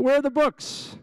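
imitating a teacher's high-pitched demand; mock-indignant question, voice jumping way up; alarmed teacher. Teacher finds books missing, acted.

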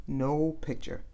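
usual speaking voice

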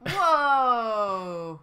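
loud, drawn-out whoa; acted amazement. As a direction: let out a long impressed exclamation. Character reacts to music.

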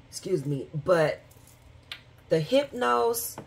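ordinary delivery

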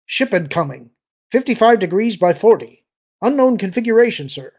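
loud, high, urgent report; snapped alert. A narrator's reading. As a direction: shout the warning across the bridge. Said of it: "a voice snapped from the sensor station".

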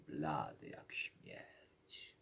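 slow breathy whisper of scorn; mocking whisper. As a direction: hiss it out slowly like a mocking aside. Princess mocking suitors. (drwiący szept)

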